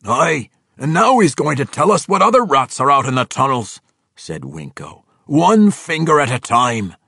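dwarf Winko eager to torture the orc; menacing dwarf. Acted. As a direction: relish the cruelty.

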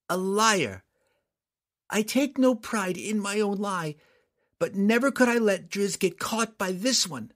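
a daughter standing firm, voice lifted high; earnest young girl. Acted. Girl defends Drizzt.

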